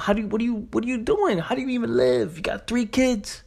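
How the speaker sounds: can't believe how anyone lives like this; incredulous. Real, not acted. To listeners, about struggling on eight hundred a month, high incredulous questions. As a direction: pitch jumps way up in disbelief.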